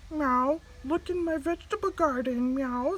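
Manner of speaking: cat voice with meows. A narrator's reading. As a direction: meow it like a friendly cat. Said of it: "said Farmer Cat".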